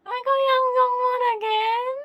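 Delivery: loud, high shriek of disbelief; squealing I got him again; excited shout. Real, not acted. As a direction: yell it out, half laughing at the bad luck. Unboxing a repeat photocard.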